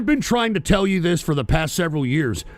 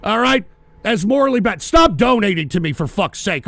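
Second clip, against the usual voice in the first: usual speaking voice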